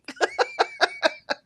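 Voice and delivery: a bright laugh jumping high. Real, not acted. Laughing at her own confusion over accents, high laugh. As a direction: burst out laughing at yourself.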